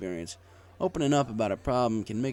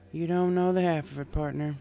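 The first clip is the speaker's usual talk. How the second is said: country mare; 7 semitones higher than usual. A narrator's reading. Applejack voiced in story.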